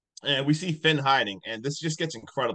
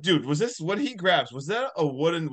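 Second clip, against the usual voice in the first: usual speaking voice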